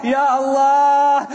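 slow, drawn-out cry to God; wailed prayer with long vowels; anguished invocation. Acted. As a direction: call out to God in grief. Voices Zainab calling on God.

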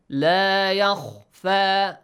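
yakhfa with a full-mouthed heavy kh, slowly; heavy kha. Shown as a demonstration. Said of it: "With a full mouth".

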